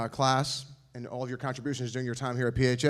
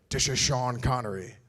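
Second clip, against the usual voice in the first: put-on slow, slurred Scottish accent, dishes for this is; lightly imitating Sean Connery's Scottish accent; slower than usual. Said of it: "He's Scottish and he talks like that".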